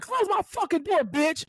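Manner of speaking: high, irate patient imitation; mock angry order. Patient ordering therapist to close his door, mock anger. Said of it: "He was like".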